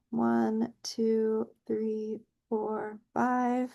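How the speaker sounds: counting under her breath while stamping; soft counting. Real, not acted. Teacher counts softly to the class while stamping. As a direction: hush the count as the stamp presses down.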